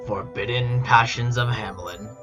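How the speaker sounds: ordinary delivery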